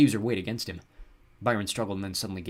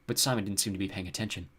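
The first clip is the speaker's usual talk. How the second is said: usual speaking voice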